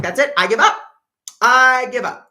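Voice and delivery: loud, high frustrated outburst; giving up in anger. Retold or imitated. After hurling the book at the wall, a loud angry give-up. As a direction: throw up your hands and yell it. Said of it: "threw it against the wall".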